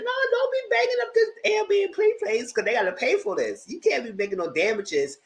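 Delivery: high, mock-stern scolding. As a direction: tell him off like a landlady. Scolding him about the Airbnb.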